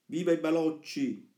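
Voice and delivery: high, drawn-up cheer of schoolboys; mock cheering slogan. Reading a misspelt slogan from the walls, cheering. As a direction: chant it like a gleeful boy's slogan. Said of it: "si leggevano, scritte col carbone".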